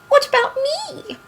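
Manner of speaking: very high, squeaky little voice; Piglet. A narrator's reading. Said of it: "said in his squeaky voice".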